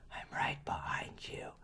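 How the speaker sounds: whispered creature voice; creepy whisper. Retold or imitated. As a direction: hiss it like a lurking ghost. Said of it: "if I heard that creature say".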